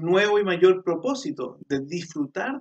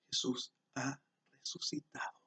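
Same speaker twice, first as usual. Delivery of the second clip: hushed whisper of good news; soft whispered proclamation; 11 dB quieter than usual. Real, not acted. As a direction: breathe it as a secret joy. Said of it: "whispered proclamation".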